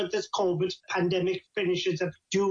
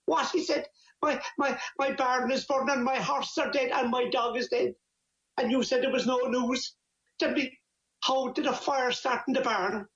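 high-pitched, aghast tally of losses; acted shocked disbelief; 6 semitones higher than usual. Man hearing neighbour's bad news, acted shock. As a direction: pitch it up in storyteller's mock dismay. Man hears of barn, horses, dog.

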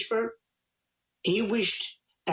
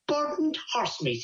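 higher, drawn-out disbelieving repeat; incredulous echo; 7 semitones higher, slower than usual. Acted. Man echoing his neighbour's shocking news, acted disbelief. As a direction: echo it slowly, pitched up in disbelief.

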